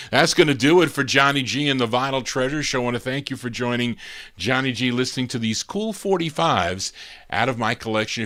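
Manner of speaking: loud, punchy back-announce of the record; booming oldies radio host voice; radio DJ announcer. Acted. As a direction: sell the song and the show like a late-night DJ.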